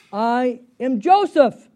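loud, slow, high declaration; booming slow announcement of identity; dramatic reveal. Acted. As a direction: proclaim who you are, drawing out each word.

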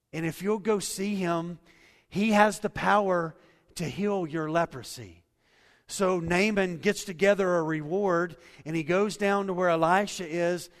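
loud, driving sermon delivery; full-force preaching. Acted. Pastor urging the church to trust, loud preaching. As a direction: preach it out, pushing every line.

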